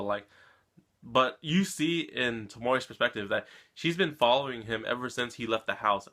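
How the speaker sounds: usual speaking voice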